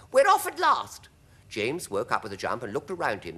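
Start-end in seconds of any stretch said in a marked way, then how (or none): 0.1-1.1 very high, loud cheer; high-pitched jubilant yell; excited shout. A narrator's reading. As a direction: shout it with relief and glee. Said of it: "someone was shouting".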